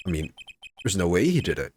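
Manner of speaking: ordinary talk, nothing marked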